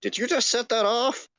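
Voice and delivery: high alarmed question; sudden alarm at the bang. Acted. Alarmed supervisor.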